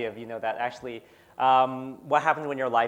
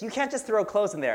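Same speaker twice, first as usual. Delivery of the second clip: mild mock yell, pitched much higher; imitated scolding shout; 8 semitones higher than usual. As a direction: imitate a parent telling the kids off. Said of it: "She's always shouting at my kids".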